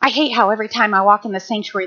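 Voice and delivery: ordinary talk, nothing marked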